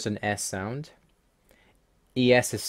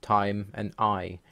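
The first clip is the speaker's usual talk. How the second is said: ordinary talk, nothing marked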